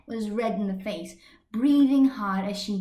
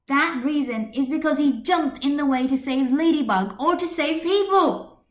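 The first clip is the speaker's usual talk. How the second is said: higher, louder, building as she argues; voice rising; 5 semitones higher, 6 dB louder than usual. A narrator's reading. Marinette arguing with Adrian in class, voice rising. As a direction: climb in pitch as you insist. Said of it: "her voice starting to rise".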